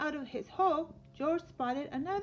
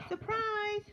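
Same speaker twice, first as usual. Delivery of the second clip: high, bright "Surprise!" with a lift; spring it on George like a gift; cheerful surprise; 8 semitones higher than usual. Acted. Friend springs the dig on George, cheerfully surprised.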